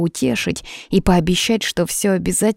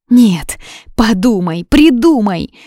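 loud, high, insistent urging; desperate demand; 5 semitones higher, 6 dB louder, slower than usual. A narrator's reading. Юна demands Кейден find a way, desperate and loud. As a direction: press frantically for a solution.

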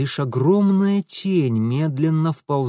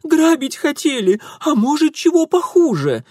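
shrill shriek, much higher and louder; shrieking plea; 13 semitones higher, 5 dB louder than usual. A narrator's reading. Girl shrieks to the bear for rescue. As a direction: shriek it out pointing at the thieves. Girl keeps pleading loudly.

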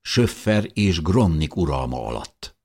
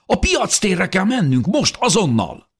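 high, loud, urgent cry; excited shout; 6 dB louder than usual. A narrator's reading. Dávid tells the family to go now, shouting excitedly. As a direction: urge everyone out immediately at full pitch. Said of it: "kiáltotta Dávid izgatottan".

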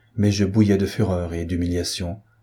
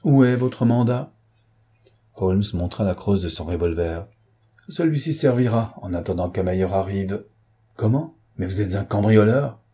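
plain reading voice

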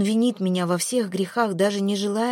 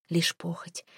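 whispered; 5 dB quieter, slower than usual. A narrator's reading. Mel fearing Jared's lust, in a sudden whisper. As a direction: drop to a whisper on this. Narrator dreads his lust.

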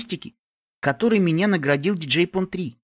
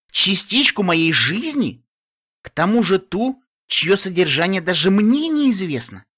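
raised, slow, outraged questions; indignant outburst; 5 semitones higher, 5 dB louder, slower than usual. Acted. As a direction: protest loudly at the very idea.

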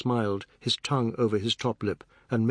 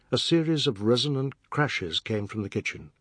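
ordinary delivery

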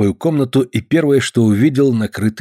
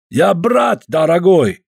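put-on slow, higher, drawn-out Caucasian accent; 7 semitones higher, 3 dB louder, much slower than usual. Georgian guest chides the late host, slow Caucasian accent. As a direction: stretch each word with a heavy Caucasian accent.

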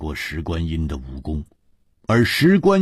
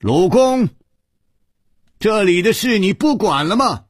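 high, raised-voice challenge; loud call; 12 semitones higher, 4 dB louder than usual. A narrator's reading. Xiao Shi calling after the departing old man, high loud call. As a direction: call out after someone who is leaving. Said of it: "大声地说".

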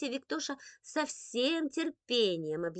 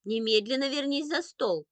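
loud, strict summons; commanding motherly call; stern mother; 4 semitones higher, 7 dB louder than usual. A narrator's reading. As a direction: order a child back firmly. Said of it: "строгим голосом позвала мама".

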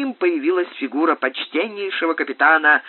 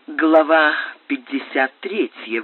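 usual speaking voice

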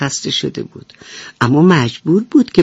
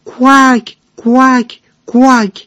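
mother duck calling; 7 semitones higher, 3 dB louder, much slower than usual. A narrator's reading. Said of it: "از دور صدایی شنید".

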